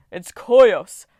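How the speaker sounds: a Titan bellowing his own name; loud proud yell; booming shout. Acted. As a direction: roar it out proudly. Titan announcing himself loudly.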